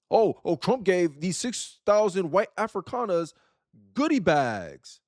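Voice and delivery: drawn-out sarcastic whine, slow; slow mocking complaint about goodie bags; mock outrage. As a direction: parody the other side's complaint, slowly.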